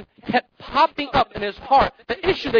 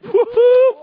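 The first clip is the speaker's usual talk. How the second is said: loud high woo-hoo, cheering; excited whoop; 11 semitones higher, 6 dB louder than usual. Acted. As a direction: whoop like a proud kid showing off. Saul boasting, acted cheer.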